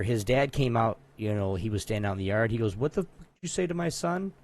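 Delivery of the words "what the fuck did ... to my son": high-pitched mock indignant challenge; retold outrage, pitched up; confrontational dad. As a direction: play an affronted father demanding an answer. Retold angry dad confronts him.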